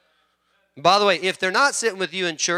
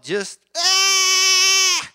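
high imitated scream of a woman in labor; mock labor scream; 4 dB louder than usual. Pastor mimicking his wife's labor, a hammed-up scream. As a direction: let out a wife's birthing scream.

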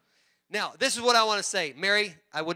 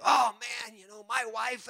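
mock complaining husband; 2 semitones higher than usual. Husband griping about his wife, hammed-up whine. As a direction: ham up a whiny husband. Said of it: "men, when you".

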